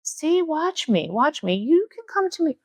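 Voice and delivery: high coaxing, showing off to a friend; sing-song beckon to the moth; mock coaxing. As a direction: coax the moth while bragging. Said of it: "saying to Michelle across from me".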